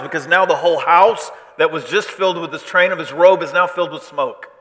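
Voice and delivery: loud, high, driving sermon delivery; full-force preaching. Acted. Preacher telling how worship suddenly changes, full-force preaching. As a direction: preach it with mounting urgency.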